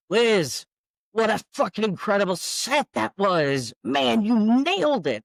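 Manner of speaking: high, loud retold gushing; excited praise. Colleague congratulates singer on her set, thrilled. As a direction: gush like an impressed colleague.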